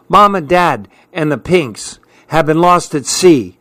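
plain reading voice

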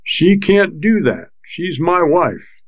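she's my wife dragged out slowly in rising rage; a drunk husband flaring at the sergeant; sudden rage. A narrator's reading. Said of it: "sudden rage began to show in his eyes".

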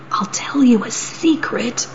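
secretive goat whisper. Acted. Goat whispers a secret to the troll. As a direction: lean in and whisper it.

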